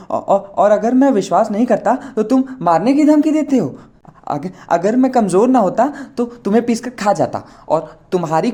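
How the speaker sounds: put-on high, loud tearful old-man rant; weepy old man. A narrator's reading. Old gora accuses Chandar, high crying old-man rant. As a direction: rant through tears, stuttering. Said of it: "उसका रोना नहीं रुका".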